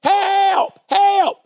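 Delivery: high, loud cries for help from down a hole; yelling for help. Retold or imitated. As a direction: call out like someone trapped and desperate. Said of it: "kept hearing the noise".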